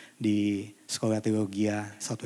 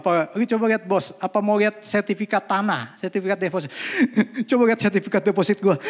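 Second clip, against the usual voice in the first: higher voice, laughing through it; lively, higher-pitched joking imitation; amused mock quote; 7 semitones higher, 4 dB louder, faster than usual. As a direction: mimic an absurd request, tickled. Mocking absurd deathbed wish, amid laughter.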